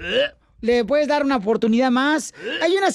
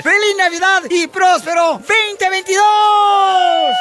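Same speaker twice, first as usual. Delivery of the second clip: loud, higher, slow holiday cheer; festive shout; 6 semitones higher, 6 dB louder, slower than usual. Acted. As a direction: belt out the season's greetings slowly. Closing holiday shout in promo.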